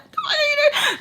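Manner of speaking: very high squealed imitation; scared waking. Friend waking on the floor, scared high mimicry. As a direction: mimic someone jolting awake in fright, half laughing. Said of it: "just wakes up scared".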